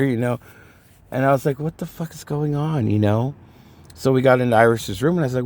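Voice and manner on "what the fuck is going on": higher-pitched retold exasperated confusion; mock-bewildered question. Retelling his own bewilderment.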